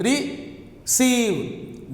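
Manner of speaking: Receive as just two beats, re, then ceive; split into syllables. Shown as a demonstration. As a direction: correct Receive with a pause before ceive. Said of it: "No, you can't say that".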